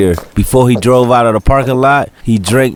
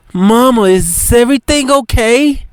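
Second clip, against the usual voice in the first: lightly voiced worried child; 14 semitones higher than usual. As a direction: ask timidly as a kid. Child asks mother.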